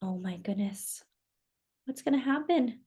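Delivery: ordinary delivery, nothing marked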